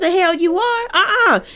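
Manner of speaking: put-on a mother shutting her kid down hard; scolding mom. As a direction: mom scolding her daughter about the police. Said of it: "that's how she said it".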